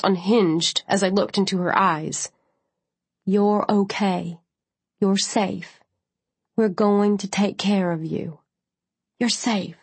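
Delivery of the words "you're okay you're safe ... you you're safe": calm her down gently; reassuring. A narrator's reading. EMT holding face, reassuring.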